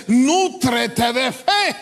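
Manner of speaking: slow, weighty divine reply; God's answer. Retold or imitated. God answering the preacher in prayer, slow and solemn. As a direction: let each word land slowly. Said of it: "Pero entonces Él me dice".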